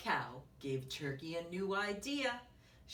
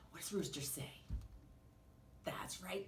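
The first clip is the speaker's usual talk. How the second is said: plain reading voice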